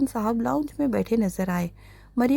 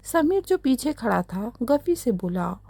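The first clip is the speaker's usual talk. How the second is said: ordinary delivery, nothing marked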